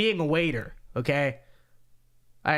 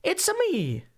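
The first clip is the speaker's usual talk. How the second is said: much higher Mario impression; high cartoon Mario voice; 13 semitones higher, slower than usual. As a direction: squeak it like the plumber. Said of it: "I feel like it's higher than".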